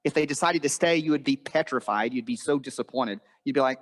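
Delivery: ordinary talk, nothing marked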